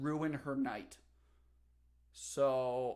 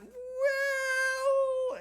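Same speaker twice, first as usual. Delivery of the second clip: sheepish well; 8 dB louder than usual. Retold or imitated. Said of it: "And I said".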